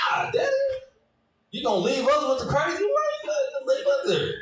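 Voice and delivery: put-on high-pitched whining child; child's high plea. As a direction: beg him in a high little-kid voice. Kid begging dad not leave.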